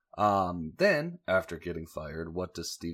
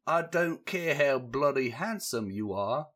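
mock British; 7 semitones higher, 5 dB louder than usual. Steven quoted laying down the law.

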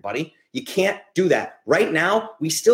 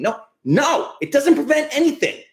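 higher, louder, exasperated no; 12 semitones higher, 3 dB louder than usual. Real, not acted. Host rebutting supplement-hungry commenters, exasperated and loud. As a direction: shoot the idea down, fed up.

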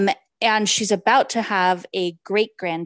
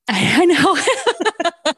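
laughing through the word; 9 semitones higher, 5 dB louder, much slower than usual. Real, not acted. Co-host teases her French-sounding Spanish, laughing. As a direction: laugh at her own accent. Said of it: "It's very French".

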